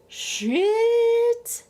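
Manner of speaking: long, high, loud 'shit'; drawn-out, high-pitched stunned reaction; retold shock. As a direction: stretch it out high in mock shock. Retells own shocked reaction to slap.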